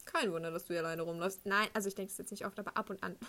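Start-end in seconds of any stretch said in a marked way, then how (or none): 0.0-1.5 lower, mock judgemental inner voice; judging thought. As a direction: drop the pitch for an imitated scornful thought. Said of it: "denk ich mir manchmal schon so". (gespielt abfälliger Gedanke, tiefer)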